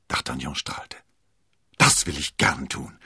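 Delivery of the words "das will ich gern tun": loud, glad agreement; eager exclamation. A narrator's reading. Beaming D'Artagnan offering the Duke escort, eager. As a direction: call it out readily. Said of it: "rief er".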